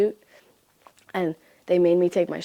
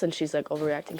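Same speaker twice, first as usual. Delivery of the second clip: ordinary talk, nothing marked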